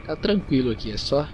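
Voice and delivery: ordinary delivery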